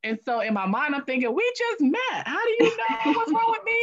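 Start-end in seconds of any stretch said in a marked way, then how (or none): 1.3-3.8 high-pitched incredulous protest; mock indignation. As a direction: replay your baffled inner outburst in a high voice. Retold inner protest at attendee.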